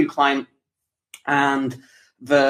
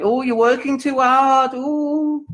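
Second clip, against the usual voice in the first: mocking nag; 10 semitones higher, slower than usual. Mocking people who nag him.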